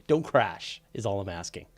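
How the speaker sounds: usual speaking voice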